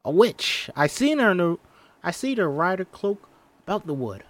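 high, urgent insisting; insistent. Acted. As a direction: insist it was a witch. Child insisting it was a witch.

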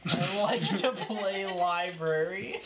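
slow, drawn-out mock echo; mocking repeat. Friend echoing a silly translation, slow mocking repeat. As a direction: stretch it out slowly, poking fun.

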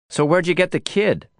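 a hint of Lisa sizing him up; lightly voiced Lisa. A narrator's reading. Lisa asks drowsily.

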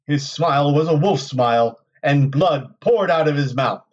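story narration. A narrator's reading. Narrator reading a chapter to listeners, dramatic storytelling. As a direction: read it out with deliberate dramatic phrasing. Reads novel chapter aloud.

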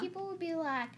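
ordinary delivery

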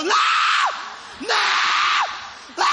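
shrieked high noes; hammed-up kid begging; child protesting. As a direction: scream no while being dragged. Child dragged to be hit.

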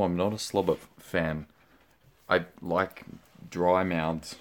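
ordinary talk, nothing marked

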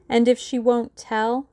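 pointed, sudden query; sudden question. A narrator's reading. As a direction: break in with the question, pitched high. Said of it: "Julius asked suddenly".

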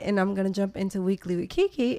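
ordinary talk, nothing marked

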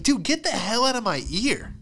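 high-pitched annoyed protest; mock irritation. Student to whispering kid behind him, irritated. Acted complaint to whispering classmate.

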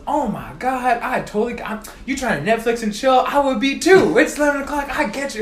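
excited support agent cheering her caller on; gushing rep voice. Retold or imitated. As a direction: play the over-friendly phone agent, all sympathy. Customer rep gushing sympathetically.

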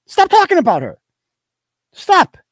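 high-pitched, emphatic order to stop; exasperated scolding. Real, not acted. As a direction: scold her in real exasperation. Scolding the absent mother.

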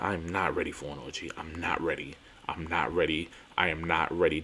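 low, hushed insistence; subdued refusal. Retold or imitated. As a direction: repeat it low and soft, as if to yourself. Repeats refusal, low before emphatic take.

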